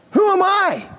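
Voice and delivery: hint of a protesting Moses; lightly voiced Moses. Moses to God, lightly acted high pleading protest. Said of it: "Moses cried".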